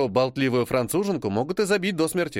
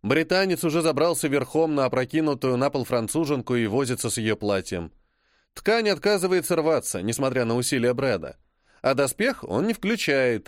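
plain reading voice

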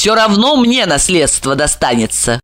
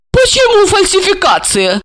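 higher, feigned innocent surprise; mock surprise; 13 semitones higher, 3 dB louder than usual. A narrator's reading. Marina feigns surprise at Olga, higher innocent question. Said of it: "удивилась Марина".

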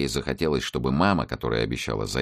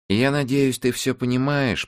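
apologetic woman; 11 semitones higher than usual. A narrator's reading. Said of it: "виноватому тону".

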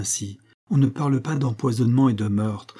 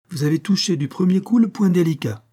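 higher, keen congratulation; enthusiastic approval; 6 semitones higher than usual. A narrator's reading. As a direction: praise the doctor's insight with lifted voice. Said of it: "sous le nom du voyageur enthousiaste". (félicitations enthousiastes, voix plus haute)

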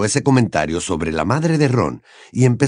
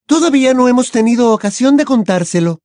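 defensive; 5 dB louder than usual. Acted. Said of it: "que se defendió".

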